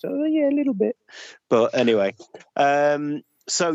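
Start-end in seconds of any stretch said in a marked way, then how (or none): 0.0-1.0 high, sheepish little confession; cheeky admission. Retold or imitated. Admitting to the Yorkshire lady he was mocking her, high and sheepish. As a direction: own up with a high guilty squeak.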